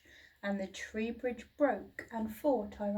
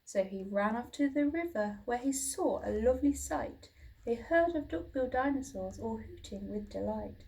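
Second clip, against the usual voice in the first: plain reading voice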